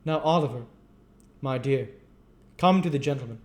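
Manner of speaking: high, loud fake-sweet beadle; aloud, falsely kind. A narrator's reading. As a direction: sugar it for the gentlemen. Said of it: "said aloud".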